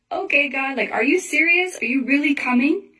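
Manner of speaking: half-laughing "are you serious" to God; excited surprise, retold; incredulous. Retold disbelief at sign.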